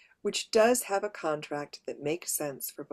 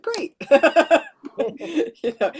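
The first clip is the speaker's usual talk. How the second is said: laughing; 11 semitones higher, 10 dB louder, slower than usual. Retold or imitated. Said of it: "And I said".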